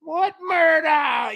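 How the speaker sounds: high, drawn-out New York shout; classic New York. Acted. Co-host showing off classic New York, high slow holler. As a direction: stretch it out in a loud New York accent. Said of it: "the classic New York".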